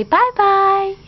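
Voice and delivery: high, loud cooing "Bye-bye"; sing-song bye-bye. Shown as a demonstration. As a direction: model "Bye-bye" brightly for a toddler. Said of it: "You say".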